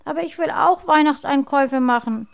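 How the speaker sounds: high, loud tearful protest; sobbing little girl. A narrator's reading. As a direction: protest as an upset small child. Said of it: "Lena schluchzte auf".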